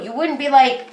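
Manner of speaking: ordinary talk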